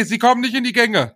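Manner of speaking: ordinary talk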